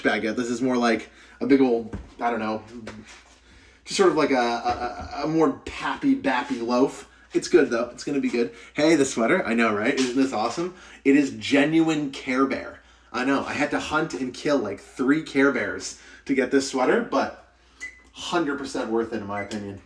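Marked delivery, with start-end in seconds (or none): none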